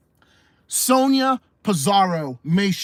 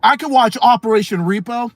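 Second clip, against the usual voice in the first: ordinary talk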